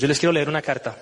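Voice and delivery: ordinary talk, nothing marked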